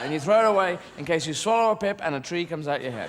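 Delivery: ordinary delivery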